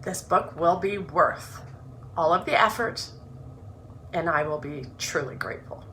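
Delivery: earnest, measured reading of her own introduction; reading her book aloud. Acted. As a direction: read it like a heartfelt opening chapter.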